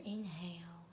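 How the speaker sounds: calm, unhurried meditation voice with long pauses; soothing meditation guide, letting phrases settle; guided meditation. Acted. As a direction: guide listeners gently through breath and body, leaving space after each phrase.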